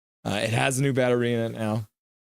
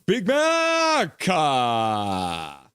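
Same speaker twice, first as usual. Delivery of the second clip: drawn-out announcer hype; much slower than usual. Real, not acted. Thanking a donor of a gifted membership, drawn-out hype. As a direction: raise and stretch the name.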